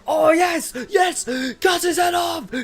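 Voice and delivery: high, loud, drawn-out mock shout; imitating Hilda cheering on a beheading; bloodthirsty cheering. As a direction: scream it from the sidelines, thrilled. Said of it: "She's like, she's like".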